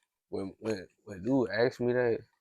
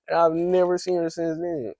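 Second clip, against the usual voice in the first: ordinary delivery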